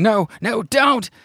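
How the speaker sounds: high, hammed-up panicked pleading; mock scream. Professor attacked by the giant wasp, mock screaming. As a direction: cry out as an imitated victim. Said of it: "you hear Professor Larvae screaming".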